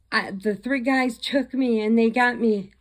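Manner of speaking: high, urgent call for help; acted frantic plea. Captive's frantic phone message.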